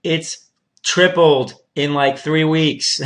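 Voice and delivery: exaggerated "come on, man" reply; incredulous retort. Acted reply to panicking people.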